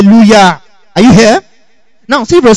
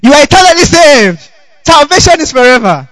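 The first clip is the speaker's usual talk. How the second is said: high-pitched mock preacher's proclamation; young pulpit preacher; 7 semitones higher than usual. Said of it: "standing at the pulpit saying".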